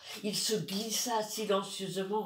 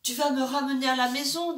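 voix de fillette plus aiguë, plus forte et pressée; petite fille inquiète; 6 semitones higher, 6 dB louder, faster than usual. Acted. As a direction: une enfant perdue qui supplie vite. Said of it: "demanda-t-elle". (petite fille qui demande, plus aiguë et rapide)

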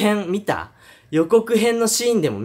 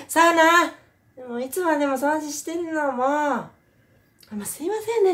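high mother yelling, then sweetly polite; mother's voice; 12 semitones higher than usual. Retold or imitated. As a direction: snap from scolding to courtesy.

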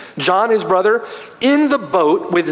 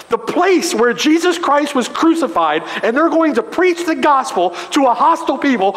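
higher, climbing fervent preaching; preaching at full force; 7 semitones higher than usual. Acted. Preacher urging the church toward Jerusalem, fervent and rising. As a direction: drive it home with rising urgency.

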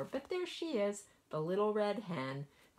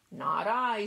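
squealing pig; 4 semitones higher, 6 dB louder than usual. A narrator's reading. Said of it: "squealed the pig".

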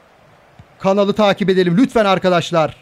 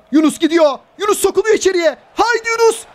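excited commentary; 10 semitones higher than usual. Real, not acted. Attack building toward goal.

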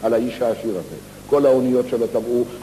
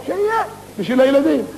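put-on punchline; 12 semitones higher than usual.

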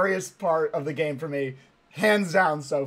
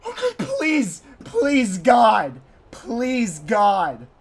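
high, frightened begging; high-pitched desperate prayer; desperate pleading; 4 semitones higher, 4 dB louder, much slower than usual. Real, not acted. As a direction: plead to heaven in real panic. Terrified pleading before game jump.

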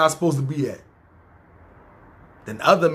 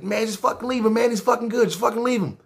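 imitating Brian; 5 semitones higher than usual. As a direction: put on Brian's higher voice, easy and pleading. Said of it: "That's how he talk".

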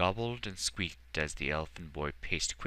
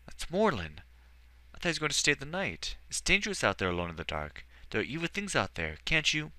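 put-on higher young boy voice, fast and worried; a child fretting for his friend; boy fearing for Morlun in the dark, anxious; 10 semitones higher, 4 dB louder, faster than usual. A narrator's reading. Edward worried at the window.

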